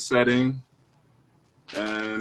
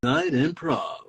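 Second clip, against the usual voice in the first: slow show announcer, lightly voiced; slower than usual. Cohost announcing the late night show in an announcer voice. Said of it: "that's a great voice".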